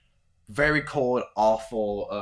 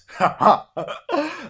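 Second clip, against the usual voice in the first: put-on wet, ugly old man's cackle; a gross wheezing laugh at the storm; 10 dB louder than usual. Old raider laughs grossly at the storm. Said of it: "laughs a gross laugh".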